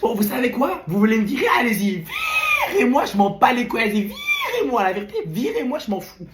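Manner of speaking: shouted dare then a mocking laugh; defiant outburst. Defiant student daring the teacher to fire him.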